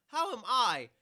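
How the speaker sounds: high, loud indignant yelp; exasperated high yell; indignant shout. Real, not acted. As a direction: protest it loudly. Indignant rant about Erica.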